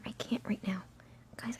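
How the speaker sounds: ordinary delivery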